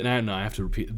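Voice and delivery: ordinary delivery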